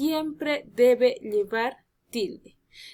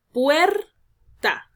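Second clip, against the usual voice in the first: "Puerta" as PUEEER... ta, first syllable high and loud; syllable-by-syllable stress; 4 semitones higher, 6 dB louder than usual. Shown as a demonstration. Teacher demonstrating prosodic accent, PUER of "Puerta" stressed. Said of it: "se tiene que pronunciar".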